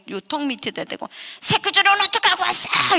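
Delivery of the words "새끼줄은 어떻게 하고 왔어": very high, loud scolding; angry shrieked demand. Retold or imitated. Stepmother confronting father over the rope, shrieking. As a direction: shriek it like an accusation.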